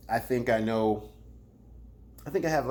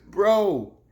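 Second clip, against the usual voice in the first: high, loud "Bro" through a laugh; laughing disbelief; 7 semitones higher, 7 dB louder than usual. Acted. Vlogger laughing at Ryder's diss, loud laughing "bro". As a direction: laugh it out at him.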